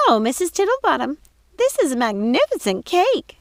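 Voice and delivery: high, squealing delight over cake; squealed. Acted. Ruby squeals to Mrs Tittlebottom about the cake. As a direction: squeal it out like a greedy sister. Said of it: "squealed Ruby".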